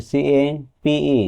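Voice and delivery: very slow, letter-by-letter spelling of সিএপিই. Shown as a demonstration. Teacher spells a word for students, drawn out letter by letter. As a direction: stretch out each letter of সিএপিই. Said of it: "শেষে একটা ই হওয়ার কারণে".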